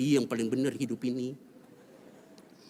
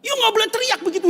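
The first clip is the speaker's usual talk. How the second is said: put-on high, loud, rushed scolding child; child's fast shout; 10 dB louder, faster than usual. Eldest child tells shouting father off, fast loud shout. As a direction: blurt it out fast like a furious kid.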